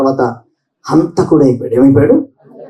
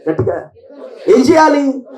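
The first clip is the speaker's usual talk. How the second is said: usual speaking voice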